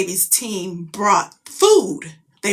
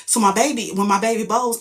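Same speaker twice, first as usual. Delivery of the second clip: normal talking voice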